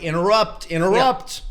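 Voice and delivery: loud mock chant of "interrupt"; mock screaming salesman. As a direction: parody salesmen calling out "interrupt". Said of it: "they may as well go around screaming".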